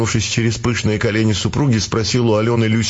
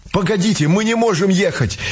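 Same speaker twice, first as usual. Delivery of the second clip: cried out; 7 semitones higher than usual. A narrator's reading. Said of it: "вскрикнул".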